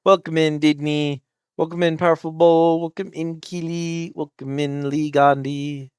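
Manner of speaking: cute anime streamer. Acted. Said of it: "my name is [name]".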